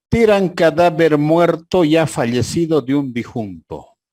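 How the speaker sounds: loud, high tabloid headline voice; headline read aloud. Retold or imitated. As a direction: announce it like a newspaper crier. Reads absurd headline aloud. (gritando el titular como pregonero)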